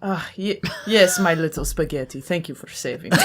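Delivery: mild swooning gratitude. Acted. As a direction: thank your little spaghetti. Ezio thanks rescuer.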